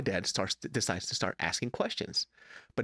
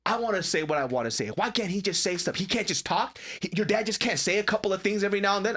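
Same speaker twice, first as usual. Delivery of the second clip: higher, quicker arguing; indignant mom; 8 semitones higher, faster than usual. Retold or imitated. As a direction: imitate mom protesting on dad's behalf. Mom argues back in retold quarrel.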